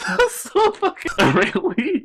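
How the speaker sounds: high, loud laughter through words; cracking up in disbelief; laughing hard. Real, not acted. Cracking up at curse story.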